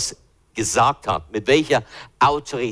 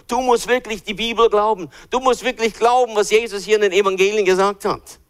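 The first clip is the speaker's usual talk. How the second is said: raised, pressing insistence on belief; urgent preaching; 8 semitones higher than usual. Acted. Preacher urging the congregation to believe, raised and insistent. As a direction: drive the point home from the pulpit.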